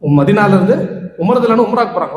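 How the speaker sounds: ordinary talk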